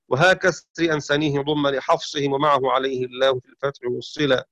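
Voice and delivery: usual speaking voice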